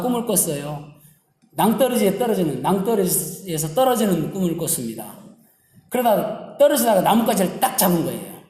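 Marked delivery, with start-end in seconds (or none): none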